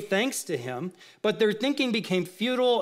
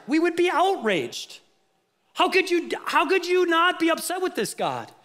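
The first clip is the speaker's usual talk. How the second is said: a preacher's indignant cry; rising outrage; 8 semitones higher than usual. Real, not acted. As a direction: let the outrage swell.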